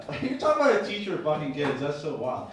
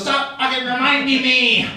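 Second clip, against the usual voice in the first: mock angry wife; 9 semitones higher, 5 dB louder than usual. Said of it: "She goes".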